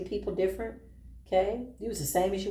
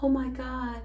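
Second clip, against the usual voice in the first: needy gushing; 5 semitones higher than usual. Retold or imitated. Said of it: "was like".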